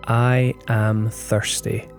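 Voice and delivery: ordinary talk, nothing marked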